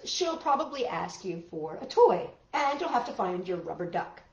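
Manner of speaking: usual speaking voice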